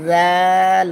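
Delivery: জাল with a soft buzzing th and held vowel; tongue at the teeth, stretch জাল; soft zal. Shown as a demonstration. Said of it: "জাল নরম". (জাল নরম করে)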